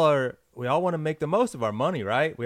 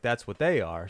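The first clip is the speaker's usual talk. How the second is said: usual speaking voice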